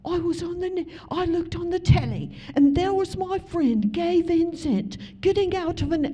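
high, lively British pastor on the phone. Retold or imitated. English pastor phoning the preacher, high and excited. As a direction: play the excited English pastor calling her. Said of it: "he goes".

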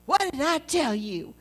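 lightly mocking his scolding-parent tone; mimicking him. To Steve, teasingly parroting his scolding line. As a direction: throw his own scolding words back at him, teasing. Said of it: "I said it back to him".

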